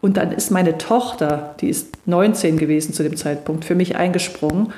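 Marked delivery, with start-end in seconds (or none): none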